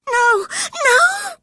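shrieked very high and loud; scream. Acted. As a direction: scream in terror. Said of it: "she screamed".

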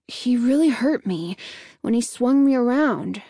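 usual speaking voice

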